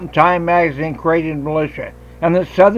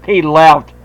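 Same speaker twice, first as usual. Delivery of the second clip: normal talking voice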